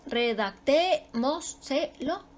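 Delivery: redactémoselo slowly chopped into re-dac-té-mo-se-lo; syllable by syllable. Shown as a demonstration. Teacher locating the stress, redactémoselo slowly in separate syllables. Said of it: "Ahí está su sílaba tónica".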